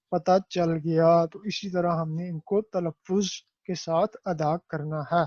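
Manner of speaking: ordinary talk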